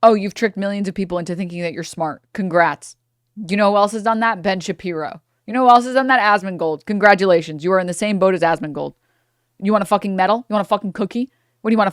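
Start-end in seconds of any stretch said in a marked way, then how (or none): 5.5-6.2 higher and louder, pressing the question; louder rhetorical jab. Real, not acted. Streamer jabbing at the guest, louder on the repeat. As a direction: punch it up on the repeat.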